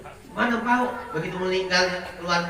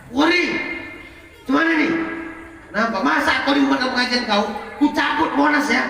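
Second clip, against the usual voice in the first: much higher, louder hammed-up angel; mock outraged Jibril; 10 semitones higher, 3 dB louder than usual. Jibril offering to uproot Monas, high and loud. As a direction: play the furious angel for laughs.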